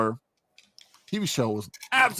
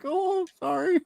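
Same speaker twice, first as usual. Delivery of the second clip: much higher, meek mock apology; meek recruit; slower than usual. Recruit answering the sergeant, high meek mock apology. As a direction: squeak out a sheepish sorry. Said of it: "You're like".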